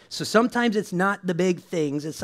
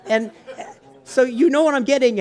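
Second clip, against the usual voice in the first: laughing through the words, louder and higher; 8 semitones higher, 9 dB louder than usual. Real, not acted. Preacher to the congregation, laughing through her joke. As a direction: let the giggle spill into the line.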